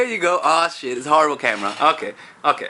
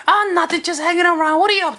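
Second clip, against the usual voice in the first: much higher, chirpy answer; eager girl; 12 semitones higher than usual. Acted. Woman answering the guy's question, high and eager.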